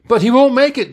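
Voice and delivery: high, loud protest; alarmed yell. A narrator's reading. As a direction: yell it out, pitch shooting up. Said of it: "Dickerson yelled".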